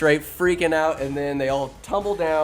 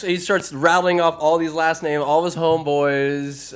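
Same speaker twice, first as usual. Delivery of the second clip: normal talking voice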